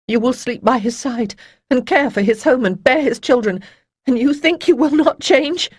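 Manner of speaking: building to a loud, high, furious cry; shouting. A narrator's reading. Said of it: "She realized that she was shouting".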